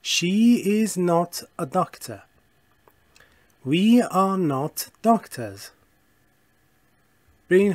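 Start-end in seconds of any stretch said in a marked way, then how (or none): 0.0-5.6 very slow, carefully spaced English; deliberate classroom model reading; slow model sentence. Shown as a demonstration. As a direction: read it out slowly for learners to copy. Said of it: "مثلاً".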